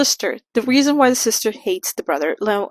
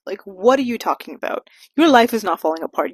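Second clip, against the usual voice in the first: usual speaking voice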